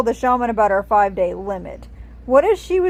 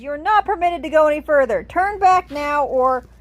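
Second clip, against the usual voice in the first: high-pitched, raised-voice order; shouted guard's warning; 7 semitones higher, 4 dB louder than usual. A narrator's reading. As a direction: shout a warning at intruders, cut off mid-sentence. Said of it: "shouting loudly".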